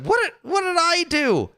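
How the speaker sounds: high squeaky outraged protest; mock indignant. Imitating Dave Portnoy protesting his innocence, high and outraged. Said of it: "Dave Portnoy's like".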